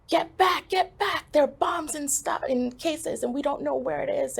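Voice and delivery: high, urgent warning yell; retold yelling. Police officer running at her about bombs, urgent yell. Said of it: "just yelling at me".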